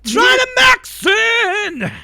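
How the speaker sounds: huge high drawn-out shout; mock battle cry. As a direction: yell it out long like a hype chant. Mock cheer echoing the clip's buzzword.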